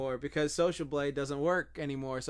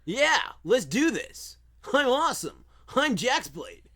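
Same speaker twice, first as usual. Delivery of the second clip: very high, loud pumped-up shout; hype coach voice; 12 semitones higher, 10 dB louder than usual. Shown as a demonstration. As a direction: hype up the listener like a gym bro. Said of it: "performs the app voice".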